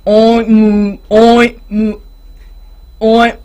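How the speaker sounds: mixing oinks and moos mid-attempt; pig trying to oink. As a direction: stumble between animal sounds. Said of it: "the pig that went moo tried again".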